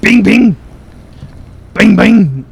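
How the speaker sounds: loud high bing bing; cheerful mock bell; imitated bell ding. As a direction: ring out like a shop bell.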